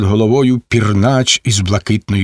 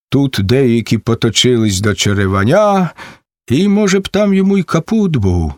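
usual speaking voice

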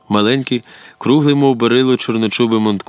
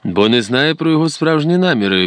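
higher-pitched firm rebuttal; curt retort; 7 semitones higher than usual. A narrator's reading. Porodovsky answering his doubting colleague, curt. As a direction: cut off the objection. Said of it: "відрубав Породовський".

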